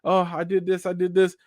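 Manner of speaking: hammed-up self-pitying parent; guilt-tripping parent, imitated. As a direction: lay on the guilt like a long-suffering parent. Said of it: "hit you with them guilt trips like".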